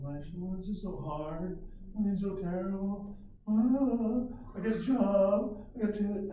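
high, whining mock complaint; whiny complainer. Preacher mocking a grumbler, high whiny voice. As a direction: whine like a worker who hates his job.

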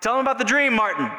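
high, loud call to the speaker at the podium; imitated shout. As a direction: shout it out like urging him on from behind. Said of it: "she shouted".

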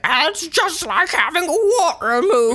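high, slow mocking killer voice; creepy high voice. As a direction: drawl it like a giggling maniac. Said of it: "he's like".

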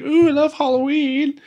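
high, squealing mock delight over presents; gushing delight. Family gushing over their Halloween presents, in a high mock squeal. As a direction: gush like a kid on Christmas morning.

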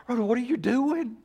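high, strained hushed call; Peter. Acted. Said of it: "And Peter's like".

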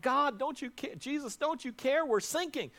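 frightened disciples begging Jesus to wake; panicked cry for help. Acted. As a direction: cry out to Jesus as the boat sinks. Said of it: "they call out".

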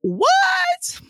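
mock-shocked high squeal; shocked outburst. Reader reacting to the hero's bold line, high shocked squeal. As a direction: shriek it very high in stunned delight. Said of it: "I'm like".

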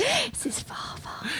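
slow, whispered big reveal; mock dramatic whisper. Co-host revealing who the guy is, in a mock dramatic whisper. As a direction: whisper it like a film twist.

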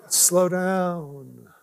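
ordinary delivery

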